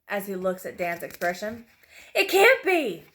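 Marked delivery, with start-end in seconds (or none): 2.1-3.0 high, loud cry of shock; screamed disbelief. A narrator's reading. Dan screams as he recognizes his laughing captor, in disbelief. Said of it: "Dan screams".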